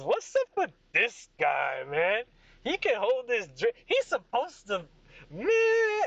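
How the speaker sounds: put-on drawn-out drunken heckle climbing high; Craig. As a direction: whine about him like a rowdy drunk. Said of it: "and he goes".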